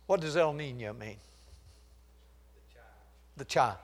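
ordinary talk, nothing marked

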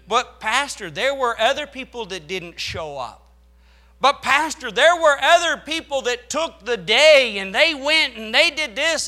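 high, loud whiny excuse; mocking church members making excuses, high and loud; mock complaining. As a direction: whine it like a complaining worker. Mocking excuse-makers' whine.

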